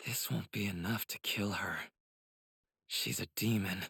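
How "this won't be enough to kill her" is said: usual speaking voice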